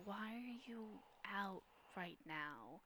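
a mysterious woman asks Holmes why he's out, hushed; wispy dark feminine voice. Acted. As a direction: let it creep in softly like a ghostly woman. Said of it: "a darker feminine voice".

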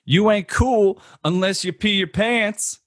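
high mock Adam Sandler whine; movie quote voice. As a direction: squeak it out like a goofy kid. Movie quote game, acted quote.

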